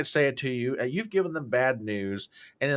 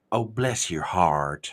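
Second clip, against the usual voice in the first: slow, low Southern drawl; drawn-out sweet Southern put-down; 6 semitones lower, much slower than usual. Retold or imitated. Southerner to a silly idea, slow low drawl. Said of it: "that's when you look at them and go".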